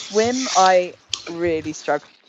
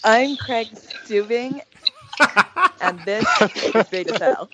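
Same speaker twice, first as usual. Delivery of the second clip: put-on slow, drawn-out Californian teen accent, a bit higher, laughing at the end; impression of Craig's accent; 4 semitones higher than usual. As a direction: mimic Craig like an early-2000s California mall kid. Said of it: "do your best accent of Craig for me".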